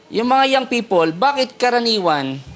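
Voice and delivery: ordinary talk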